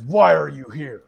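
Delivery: lightly voiced confronting minotaur; loud, sudden challenge from a mock minotaur; charging minotaur. Minotaur charging up to him, mildly voiced challenge. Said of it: "this thing charges at me".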